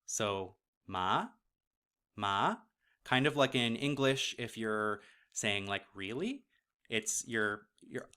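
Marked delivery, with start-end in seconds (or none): 0.9-2.6 "ma" gliding up from mid pitch; second-tone "ma", sliding upwards; rising tone. Shown as a demonstration. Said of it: "at a mid level and gliding upwards". 5.9-6.4 "Really?" inflected sharply upwards; "Really?" sliding up into a question; rising question tune. Shown as a demonstration. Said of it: "inflecting upwards".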